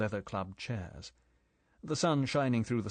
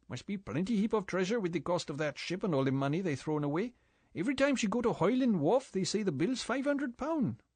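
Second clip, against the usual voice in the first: higher-pitched island boatman, easy and gossipy; 9 semitones higher than usual. A narrator's reading. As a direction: play the chatty local boatman, voice pitched up. Boatman character voiced.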